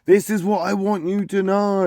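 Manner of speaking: half-hearted mock yelling at someone who can't follow. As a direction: overpronounce it, pitched well up to be understood. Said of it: "just raising your voice like".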